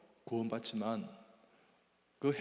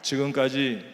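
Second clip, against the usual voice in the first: call to repeat; 9 dB louder than usual. Shown as a demonstration. Preacher leading the congregation to repeat after him, loud. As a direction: lead the crowd in a call and response. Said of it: "자, 따라합니다".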